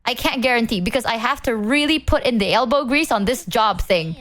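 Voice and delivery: ordinary delivery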